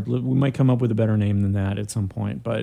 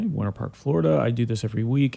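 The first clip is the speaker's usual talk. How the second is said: normal talking voice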